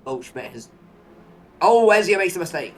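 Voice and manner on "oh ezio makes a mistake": sudden loud cry of surprise; surprised outburst. Real, not acted. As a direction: shout it out, caught off guard. Sudden mistake in live race.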